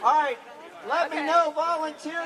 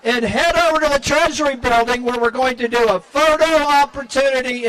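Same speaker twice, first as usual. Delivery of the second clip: announcing to a crowd; 2 semitones lower, 8 dB louder than usual. Real, not acted. Announcing through a microphone.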